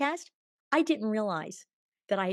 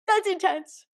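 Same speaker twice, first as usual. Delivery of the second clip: much higher and louder, blown away; stunned exclamation; 12 semitones higher, 11 dB louder than usual. Retold or imitated. After the automatic writing stopped, a stunned loud exclamation. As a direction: exclaim it as if the message just hit you.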